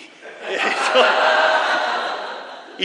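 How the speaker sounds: big laugh, high and loud; loud burst of laughter. Real, not acted. Laughing while telling story.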